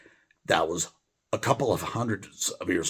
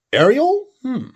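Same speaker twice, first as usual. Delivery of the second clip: baffled question; 10 dB louder than usual. Real, not acted. Puzzled by unknown word.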